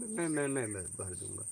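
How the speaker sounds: soft, falling stammered repetition; retold quiet offer. Offering to pay the boy's fine, quietly. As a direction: drop to a low, hushed mumble.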